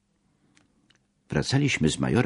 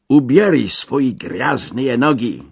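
furious shout, slow and much higher; angry shout; 8 semitones higher, 6 dB louder, slower than usual. A narrator's reading. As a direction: bark it out in rage at the soldier. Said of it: "krzyknąłem ze złością". (wściekły, powolny krzyk)

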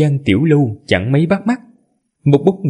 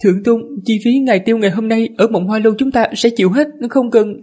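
high feminine attendant voice; man doing a woman's voice; 11 semitones higher than usual. Acted. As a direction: voice the servant as a woman, pitched up. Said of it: "Lát ra giả giọng nữ".

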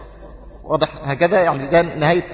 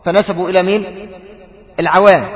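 ordinary talk

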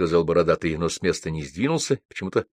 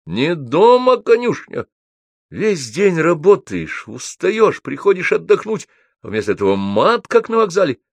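high, declamatory complaint; trained, projected voice; 11 semitones higher, 5 dB louder than usual. A narrator's reading. Добролюбов scolds the swearing writers, projected voice. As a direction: project indignation like a stage orator. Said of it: "произнёс он поставленным голосом".

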